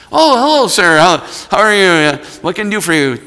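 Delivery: high, loud, eager teller voice; fawning bank teller. Retold or imitated. Retold eager bank teller greeting.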